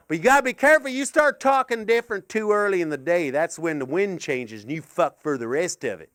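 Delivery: ordinary delivery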